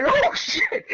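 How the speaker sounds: very high, loud mock cry; retold shocked exclamation. Classmate watching a body slam, shocked cry. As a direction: squeal it like a stunned onlooker. His shocked reaction to the fight.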